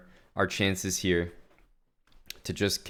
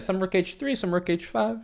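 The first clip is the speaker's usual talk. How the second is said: mock chess commentator; 10 semitones higher than usual. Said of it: "chess commentator word is the word sum".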